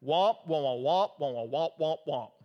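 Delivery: muffled wordless wah-wah teacher drone; imitating Charlie Brown's teacher. Preacher mimics what tuned-out readers hear, as cartoon teacher's wah-wah. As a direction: drone womps like a cartoon grown-up talking. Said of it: "here's what you hear when you read that passage".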